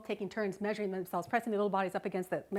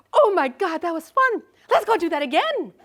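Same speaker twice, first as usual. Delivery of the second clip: higher, loud gleeful cry; thrilled mom; 9 semitones higher, 12 dB louder than usual. Retold or imitated. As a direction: gush like you just loved the ride. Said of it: "I go".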